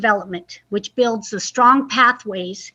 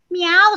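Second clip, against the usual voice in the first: high, louder meow; cat meow; 6 semitones higher, 3 dB louder than usual. Acted. Reader playing the cat, high meow. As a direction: mew like a cat for the kids. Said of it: "said the cat".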